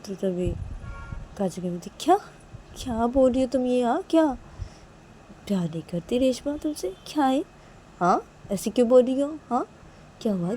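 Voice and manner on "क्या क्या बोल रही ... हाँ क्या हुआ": high-pitched, disbelieving questions; a mother questioning her upset daughter in alarm; startled, concerned mother. Acted. (हैरान माँ की ऊँची, सवालिया आवाज़)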